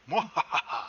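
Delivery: loud evil villain cackle; sneering panto villain. Pretend. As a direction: cackle like a cartoon supervillain. After "take over the world.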